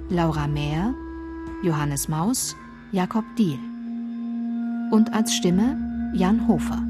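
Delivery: usual speaking voice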